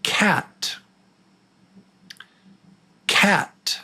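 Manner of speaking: "cat" whispered high with a front "a"; breathy test word "cat"; whispered quiz word. Shown as a demonstration. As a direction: whisper "cat", crisp final t. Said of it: "The word is".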